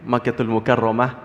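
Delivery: usual speaking voice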